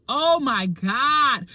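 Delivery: slow, loud, drawn-out groan of words; exasperated exclaim. Retold or imitated. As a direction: stretch it out in pain. Recalling the worst neck pain.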